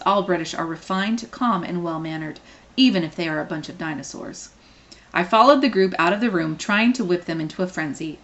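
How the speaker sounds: ordinary delivery, nothing marked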